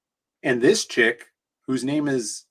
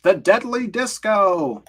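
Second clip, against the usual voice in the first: dramatic announcer; 8 semitones higher, 6 dB louder, slower than usual. Acted. Reading comic title dramatically.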